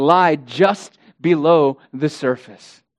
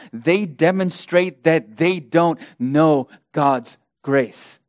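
usual speaking voice

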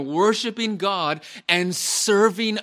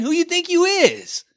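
high, loud sassy put-down; mock them with sass, way up high; sassy rebuke; 8 dB louder than usual. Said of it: "Paul is saying".

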